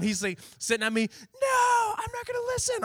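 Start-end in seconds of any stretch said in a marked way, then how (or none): 1.4-2.8 put-on much higher tearful kid refusal; whiny crying kid. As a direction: whine it through fake tears.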